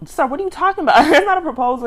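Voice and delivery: high, loud, amused disbelief; incredulous objection. Retold or imitated. Scolds the man in disbelief.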